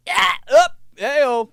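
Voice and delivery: very high, loud rooster-like call; rooster mom. Retold or imitated. Mom waking the house like a rooster, shrill-high and loud. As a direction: crow them awake like a rooster. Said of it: "That's like her rooster".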